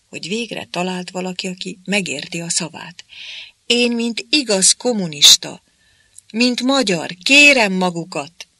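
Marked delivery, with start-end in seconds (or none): 3.7-8.5 loud plea. A narrator's reading. Smith pleads with the interpreter.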